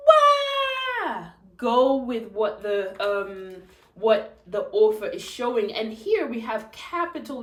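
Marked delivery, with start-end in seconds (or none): 0.1-1.3 loud, high crying "wah"; toddler wail. Acted. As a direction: bawl like a toddler. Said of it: "Trixie bawled".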